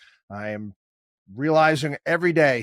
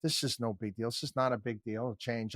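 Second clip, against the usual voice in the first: softer, unbothered soothing; calm reassurance; 3 semitones lower, 11 dB quieter than usual. Retold or imitated. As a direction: talk them down quietly. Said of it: "I said".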